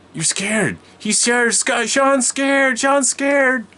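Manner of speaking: high, loud sibling taunt; shrill-pitched mocking shout, drawn out; taunting yell. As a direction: yell it like a sister telling on you. Sister taunts him in retold scene.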